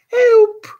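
very high, loud cry for help; piercing high scream; boy's shriek. A narrator's reading. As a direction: shriek it out like a desperate boy. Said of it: "shrieked the voice".

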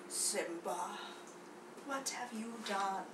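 very low, soft menacing voice; quiet stage villain; sinister villain. Acted. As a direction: accuse the cub in a hushed, deep tone. Acted villain confronts the cub.

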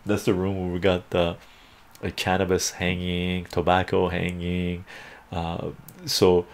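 normal talking voice

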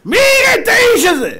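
high, loud cry of disbelief; indignant outburst. Real, not acted. Preacher scorns a disgraced leader before listeners, shouting indignantly. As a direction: throw the question out at full voice. Rhetorical outburst about the politician.

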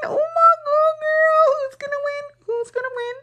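high tense anticipation; excited suspense. Real, not acted. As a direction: squeak with nervous excitement. Watching the tied poll.

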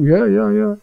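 offhand yeah. Acted. Dismissive believer quoted.

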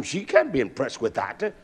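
usual speaking voice

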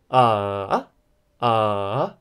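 AH dipping low, then rising; long, scooped AH. Shown as a demonstration. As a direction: let each AH sink low and climb back. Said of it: "we can call it a dipping tone".

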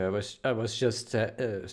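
ordinary talk, nothing marked

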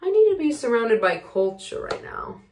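ordinary delivery

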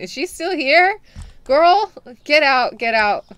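loud exasperated get out; yelling her out. Real, not acted. Shopkeeper to the lingering lady, loud and fed up. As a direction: bark her out of the shop.

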